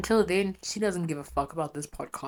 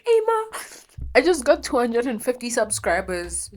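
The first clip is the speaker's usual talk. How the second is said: excited news to mom; 7 semitones higher, 6 dB louder than usual. Retold or imitated.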